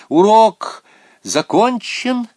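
high, loud, stretched announcement; commanding high yell; headmistress's shouted command. A narrator's reading. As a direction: call it out as an order. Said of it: "продолжала директриса".

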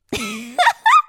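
high burst of laughter cut off; high squeal of laughter; choking laugh. A narrator's reading. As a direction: laugh and choke on it. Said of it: "Алиса поперхнулась от смеха".

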